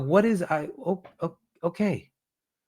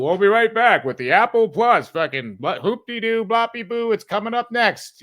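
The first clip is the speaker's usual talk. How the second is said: hammed-up broadcast promo voice, a bit higher; mock TV announcer; 5 semitones higher, 4 dB louder than usual. Streamer mocking the broadcast's endless breaks, promo voice. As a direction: parody a network tease before the break.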